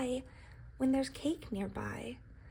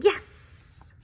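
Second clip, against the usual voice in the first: a breathy, excited little yes; whispered high-five yes; whispered cheer; 8 semitones higher, 6 dB louder than usual. Acted. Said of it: "high-fiving, saying".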